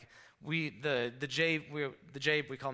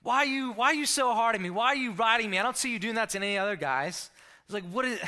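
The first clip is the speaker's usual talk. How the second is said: loud, much higher angry outburst; teenage player yelling back at a tough coach; snapping back; 9 semitones higher, 8 dB louder than usual. Retold or imitated. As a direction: fire it back at the coach, indignant. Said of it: "I snapped right back and was like".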